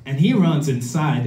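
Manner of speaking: ordinary talk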